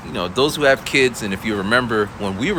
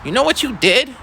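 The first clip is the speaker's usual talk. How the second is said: accusing student. Retold or imitated. Upset student confronting teacher.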